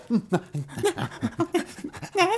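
very high little puppy yelps; puppy-like whimpers. Retold or imitated. Mimicking an actor's constant weird sounds to a co-host, puppy-like whimpers. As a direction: imitate a little puppy's squeaky noises. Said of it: "like a little puppy".

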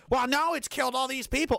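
high mocking whine. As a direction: put on a high sarcastic voice. Mocking the other side's excuse.

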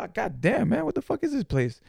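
high, startled exclamation; shocked. Retold or imitated. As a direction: yelp it in shock. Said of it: "I was like".

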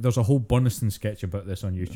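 ordinary talk, nothing marked

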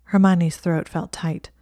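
ordinary delivery, nothing marked

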